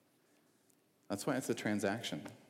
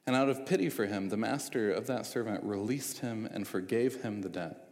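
ordinary talk, nothing marked

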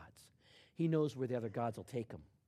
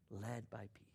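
soft whisper; 3 semitones lower, 11 dB quieter than usual. Acted. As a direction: breathe the words out gently. Preacher repeats phrase softly.

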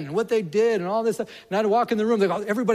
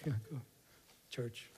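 mumbled; 5 semitones lower, 14 dB quieter than usual. Retold or imitated.

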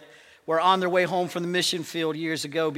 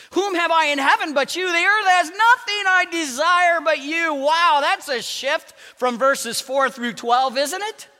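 high, loud preaching burst; 10 semitones higher, 10 dB louder than usual. Real, not acted. Preacher proclaiming the psalm, loud and high. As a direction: lift the verse up with full preaching force.

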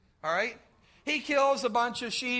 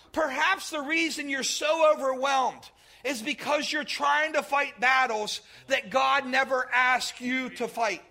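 usual speaking voice

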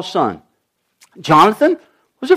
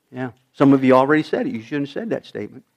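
usual speaking voice

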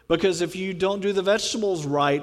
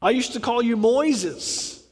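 higher, needling mock reminder; teasing jab; 4 semitones higher than usual. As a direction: rub it in playfully. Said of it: "Kind of poking them, going".

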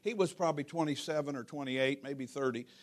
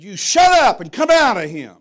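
loud, higher exorcist's command; commanding shout; 5 semitones higher, 16 dB louder than usual. Retold or imitated. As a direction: bellow it at the evil spirit to drive it out. Re-enacts commanding an evil spirit out.

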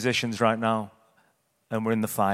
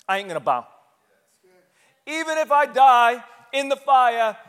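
defiant vow; 9 semitones higher, 8 dB louder than usual. Acted. The three friends refusing to bow before the fire, loud defiance. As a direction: shout it like a man who won't bow to the king.

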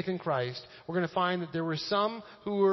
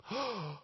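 theatrical gasp of scandal; mock shocked gasp; 5 semitones higher, 6 dB quieter than usual. Pastor playing shock at eating with Gentiles, mock gasp.

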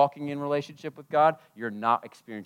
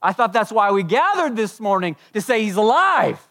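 louder, higher, proclaiming he is alive; preaching climax; 8 semitones higher, 9 dB louder than usual. Acted. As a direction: lift the room with the proclamation.

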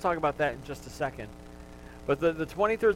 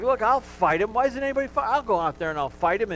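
put-on eager boy volunteering; 6 semitones higher, 4 dB louder than usual. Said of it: "he's like".

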